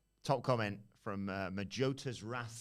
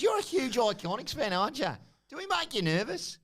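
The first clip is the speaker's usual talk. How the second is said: much higher and louder, teasing; high taunting voice; 12 semitones higher, 5 dB louder than usual. Retold or imitated. Champion taunting the nervous interviewer, a high loud tease. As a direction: needle her like a schoolyard bully.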